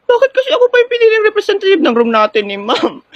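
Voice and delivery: high-pitched, dismayed wail; panicky whine. Acted. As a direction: whine about the unfair burden. Nervous contestant laments being picked. (naghihimutok sa kaba, matinis)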